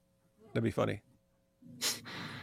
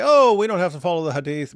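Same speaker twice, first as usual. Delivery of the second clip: lightly voiced objector, higher and louder; a louder, higher mock protest; mock dismissive quote; 4 semitones higher, 6 dB louder than usual. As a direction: imitate someone brushing it off, raised pitch. Voicing Muslims who dismiss the Hadith.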